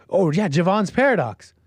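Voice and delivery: a sweating CEO insisting everyone calm down; nervous sweating voice. Retold or imitated. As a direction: play it cool while clearly panicking. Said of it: "a thought comes to you".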